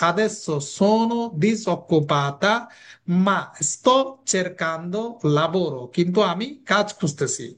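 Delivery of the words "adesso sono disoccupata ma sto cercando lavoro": the whole Italian sentence dictated word by word; slow, deliberate example for learners; very slow model sentence. Shown as a demonstration. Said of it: "মেয়ে হইলে বলবো কি?".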